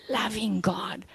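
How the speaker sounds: hushed, breathy "loving God, worshiping God"; a preacher's sudden hush for effect; whispered. Real, not acted. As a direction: drop to a reverent whisper. Preacher drops to intimate hush.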